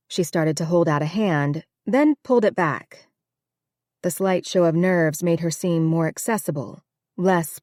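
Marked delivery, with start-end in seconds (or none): none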